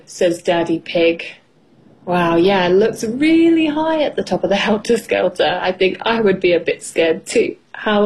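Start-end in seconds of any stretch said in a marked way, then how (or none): none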